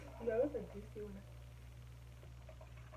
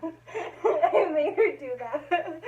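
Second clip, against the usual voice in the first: laughing; 8 semitones higher, 4 dB louder, much slower than usual. Real, not acted. Laughing at her mom on camera.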